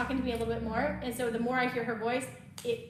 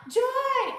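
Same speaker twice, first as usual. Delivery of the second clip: calling out a name across the intersection; high, loud shout of a name; yelled name; 13 semitones higher, 8 dB louder than usual. Retold or imitated. Stranger shouting her name across a busy intersection, loud call. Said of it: "someone yelled out".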